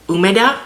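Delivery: "húmeda" said OO-meh-dah with the first syllable stressed; Spanish stress. Shown as a demonstration. Vlogger repeating the app's word to show its stress, stressing "húmeda" on the first syllable. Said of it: "showing the emphasis 'cause it does have the accent there".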